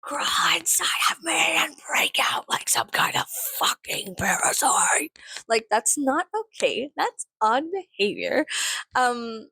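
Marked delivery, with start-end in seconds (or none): 0.0-5.2 creepy whispered come-on. Retold or imitated. Mocking creepy commenters, creepy whisper. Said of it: "whenever people are like".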